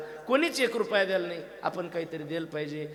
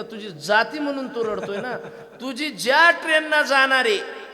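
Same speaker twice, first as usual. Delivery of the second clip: louder, much higher, slowed punchline with laughs; laughing punchline; 3 semitones higher, 9 dB louder than usual. Retold or imitated. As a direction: land the joke grinning. (हसत, मोठ्या आवाजात punchline)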